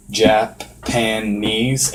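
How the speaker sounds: "Japanese" stretched slowly, piece by piece; broken into syllables. Shown as a demonstration. Explaining a picture book for learners, "Japanese" slowly broken apart. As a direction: split "Japanese" as Ja, pan, knees. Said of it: "teaches you phonetically how to say these words".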